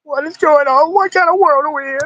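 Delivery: very high squeaky put-on alarm; hammed-up falsetto disbelief; mock panic. Host ridiculing streamer drama, in falsetto mock panic.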